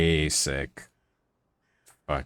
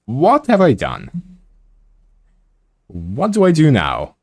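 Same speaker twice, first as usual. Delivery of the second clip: mock dismay; 9 semitones higher, 5 dB louder than usual. Mock panic at huge portion.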